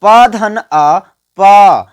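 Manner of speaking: प धन अ said slowly, short pause, then प said whole; slow syllable demo. Shown as a demonstration. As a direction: pause before the final प. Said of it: "देखिए उच्चारण पर ध्यान दीजिए".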